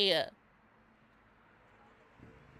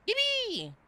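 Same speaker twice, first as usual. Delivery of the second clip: high, drawn-out squeaky yippee; playful cheer. Real, not acted. Cheering the light coming on, playful high cheer. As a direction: celebrate with a long, high-pitched squeal.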